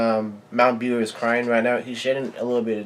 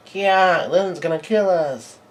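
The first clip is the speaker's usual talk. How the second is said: high, raised playful cry of alarm; mock children's yell; 7 semitones higher, 3 dB louder, slower than usual. Children yell as Lilin runs towards them, hammed-up alarm. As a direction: imitate kids squealing as they flee. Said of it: "the children yells out".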